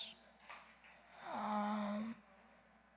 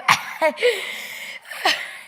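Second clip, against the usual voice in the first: breathy laugh at her slip; embarrassed laugh; 10 semitones higher, 10 dB louder than usual. Real, not acted.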